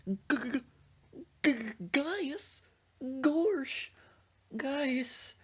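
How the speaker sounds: high-pitched stuttering cartoon voice; Goofy. Friend smells gas on the bike, imitated Goofy stutter. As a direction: stammer it out slowly, very high and cartoonish. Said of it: "Now you turned into Goofy?".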